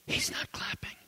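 soft repeated whisper. Acted. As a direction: let it land in a hush. Preacher repeats the girl's words.

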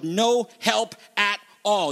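"no help at all" word by word; emphatic negation. Shown as a demonstration. Preacher stressing the Greek double negative, emphatic negation. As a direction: separate and weight each word of "no help at all". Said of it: "It's that emphatic double negative".

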